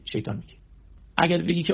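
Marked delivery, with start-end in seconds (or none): 0.1-0.6 a sermon's whispered warning; hushed whisper. Real, not acted. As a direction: drop to a breathy whisper for the reveal. Revealing who speaks.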